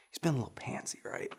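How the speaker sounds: under the breath jab; whispered aside. Real, not acted. Whispered aside mid-story.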